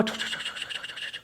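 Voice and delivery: soft unvoiced chu chu of ghostly voices; imitated whispering voices. Retelling the voices she heard, whispered chu chu. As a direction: breathe the sounds like a faint whisper. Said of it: "como un susurro así".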